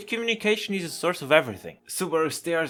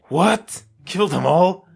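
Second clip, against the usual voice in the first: higher, louder shocked what; horrified shock; 5 semitones higher, 7 dB louder, slower than usual. Acted. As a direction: recoil in horror at the idea. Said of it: "recoils in horror at the suggestion".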